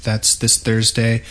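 ordinary talk

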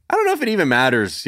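high, fast airy shrug; mimicking critics shrugging off bad accents; mocking dismissive critic. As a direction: toss it off like a careless pundit. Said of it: "they were just sort of like".

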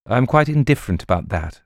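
fast, brushing-off reply; fast impatient dismissal to the rogue; hurried answer. Acted. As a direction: rush it out, eager to be done. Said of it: "answered hurriedly".

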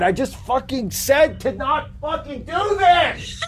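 exploding in real frustration; slow, high-pitched, almost crying; upset outburst. Real, not acted. Friend repeats the Jason voice.

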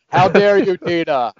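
loud, high mock outrage with no volume control; Will Ferrell character. Sketch character scolding Tina, hammed-up loud voice. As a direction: blurt it in an uncontrolled shout. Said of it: "he's got the voice and modulation syndrome".

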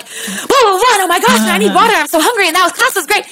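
high, rushed excited chatter; high, giddy rush of words; post-class buzz. Retold or imitated. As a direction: babble wired after teaching. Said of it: "you're like".

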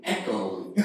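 low, soft, careful substitute swear. Retold or imitated. Churchgoer avoiding the word hella, mock pious and hushed. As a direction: drop it down quietly, mock pious.

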